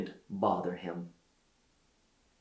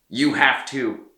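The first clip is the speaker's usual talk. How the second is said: louder, pressing insistence; firm insistence; 3 semitones higher, 5 dB louder than usual. A narrator's reading. Cardinals press Peter to accept, louder insistence. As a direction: push him to accept. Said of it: "the cardinals told him".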